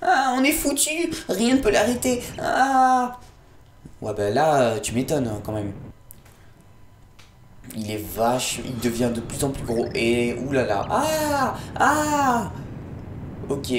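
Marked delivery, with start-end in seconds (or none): 0.0-3.2 high, alarmed cries of a frightened ally; panicked sidekick. Acted. Gamer voicing an ally panicking at the unstoppable zombie. 10.9-12.5 high scared cries. Acted. Scared cries voicing characters.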